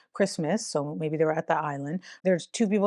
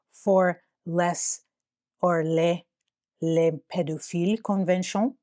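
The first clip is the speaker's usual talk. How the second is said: put-on deliberately awful French accent reading the emails; hammed-up French accent, lightly done; bad French accent. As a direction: mangle it in a cheesy French accent. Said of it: "I'm trying to do French accent horribly".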